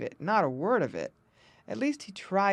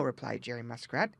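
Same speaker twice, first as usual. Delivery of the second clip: ordinary delivery, nothing marked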